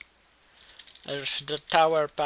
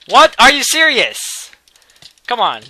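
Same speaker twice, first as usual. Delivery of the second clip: much higher, much louder, slowed disbelief; outraged shout; 9 semitones higher, 19 dB louder than usual. Real, not acted. Gamer outraged at his rival's move, shouting. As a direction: yell it at the screen.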